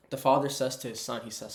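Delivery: ordinary talk, nothing marked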